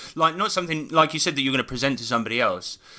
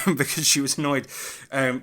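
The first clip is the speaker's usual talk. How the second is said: ordinary talk, nothing marked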